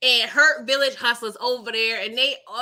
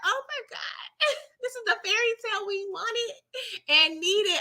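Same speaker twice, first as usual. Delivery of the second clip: much higher, swooning and giggly; gushing; 10 semitones higher, 4 dB quieter than usual. Retold or imitated. Gushing to viewers over the romantic rescue, high and giggly. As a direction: swoon over the fairy tale. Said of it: "I was like".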